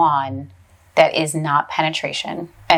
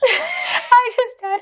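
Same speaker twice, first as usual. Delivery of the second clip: high, slow laughing squeal; dying of laughter; laughing hard, voice high; much slower than usual. Real, not acted. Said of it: "Sorry, I'm laughing".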